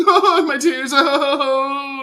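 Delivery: fake-sob like a crying skater; mock crying. Mocking the skater's tears, hammed-up wailing.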